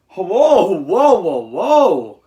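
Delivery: loud, drawn-out whoas of real surprise; surprised whoa. Real, not acted. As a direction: react to a shock score with loud whoas. Surprised at a match result.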